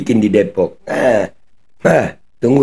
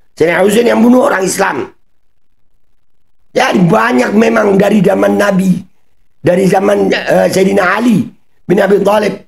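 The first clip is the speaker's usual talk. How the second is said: high, raised-voice tirade; angry rant; 10 semitones higher than usual. Real, not acted. Reactor lists victims to accuse the singer's group, ranting angrily. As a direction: rail at them in a high, heated shout.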